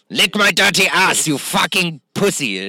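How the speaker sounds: mock dominatrix. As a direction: lay on a hammed-up domineering order. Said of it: "dominatrix-y".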